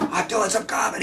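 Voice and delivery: lightly voiced mob hitman; mafia hitman voice. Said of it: "talking like a mafia hitman".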